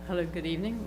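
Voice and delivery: faint greeting, far from the mic; quiet, off-mic hello; barely audible. Real, not acted. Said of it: "Could you speak up".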